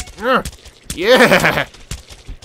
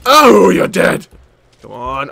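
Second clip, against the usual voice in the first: ordinary talk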